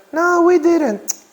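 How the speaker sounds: very high, drawn-out falsetto; mock class reply. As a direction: answer for the class in a squeaky-high, slow voice. Voices the class's answer.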